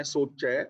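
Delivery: ਸੋਚੈ said alone, then a full stop pause; pause after ਸੋਚੈ. Shown as a demonstration. Preacher showing where to pause in the verse, resting after ਸੋਚੈ. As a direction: set ਸੋਚੈ apart and rest on it. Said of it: "ਸੋਚੇ ਤੇ ਵਿਸ਼ਰਾਮ ਲਗਾਉਣਾ ਹੈ".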